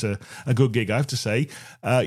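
ordinary delivery

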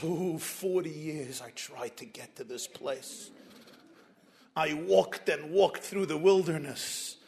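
put-on low, slow old man's voice; a tired old man, soft and low; slow, hushed voice of a weary old man. Old Moses voiced in a story.

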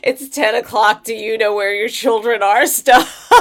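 put-on laughing through an old TV line; laughing mock announcer. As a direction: quote the old PSA while laughing. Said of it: "quoting a TV announcement".

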